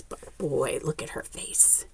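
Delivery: soft secretive whisper. Real, not acted. Storyteller hushing kids to look at the picture, whispered. Hushed aside to young listeners.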